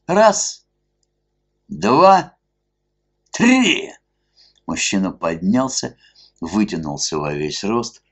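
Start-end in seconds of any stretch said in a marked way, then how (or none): 0.1-3.9 high loud drawn-out count; dramatic count. A narrator's reading. Masked man counting before tearing off the mask, loud. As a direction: count it out like a showman.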